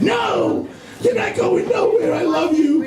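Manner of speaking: screeching wife. Retold or imitated. Wife shrieking at him at the door, very high desperate plea. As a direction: squeal it like a frantic wife begging him to stay. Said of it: "she's like".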